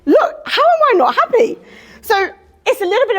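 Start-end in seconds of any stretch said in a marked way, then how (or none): 0.1-1.6 much higher and louder, exasperated; insist, pitched way up; emphatic retort. Retold or imitated. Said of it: "I'm like".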